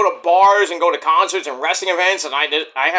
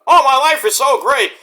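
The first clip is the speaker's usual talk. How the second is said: mocking, sing-song sarcasm, voice up high; sarcastic; 7 semitones higher, 5 dB louder than usual. Vlogger mocking his critics, sarcastic and raised. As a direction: sneer it at the haters.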